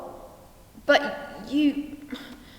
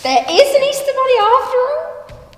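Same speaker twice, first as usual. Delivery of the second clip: high, loud burst of excitement; overjoyed hope; 11 semitones higher, 9 dB louder than usual. Acted. Nelson hearing of a wonderful twist, overjoyed. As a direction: leap in with wild, hopeful delight.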